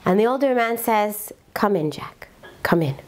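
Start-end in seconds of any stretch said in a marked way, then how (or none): 1.5-3.0 lower, whispered invitation; hushed. Retold or imitated. Father-in-law greets estranged son-in-law.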